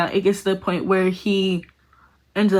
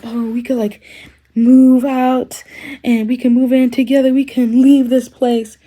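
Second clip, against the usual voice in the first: higher and louder, a hint of swept-away boyfriend; dreamy boyfriend; 5 semitones higher, 5 dB louder than usual. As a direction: lightly sell the dream of running away together. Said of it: "he starts saying".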